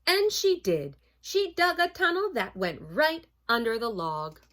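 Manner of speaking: loud, dramatic storybook reading; story narration. A narrator's reading. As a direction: build suspense for children, punching the action.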